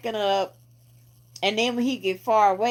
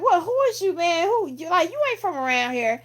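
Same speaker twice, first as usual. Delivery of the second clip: higher, demanding voice; challenging local; 9 semitones higher than usual. Retold or imitated. A neighborhood guy challenging the masked stranger, lightly voiced and higher. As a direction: question the stranger hard. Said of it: "He's like".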